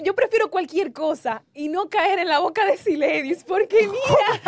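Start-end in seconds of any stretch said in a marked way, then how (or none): 3.4-4.5 higher, louder, slow, through laughter; laughing. Real, not acted. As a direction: laugh the words out. Laughing over gossip remark. (riéndose a carcajadas)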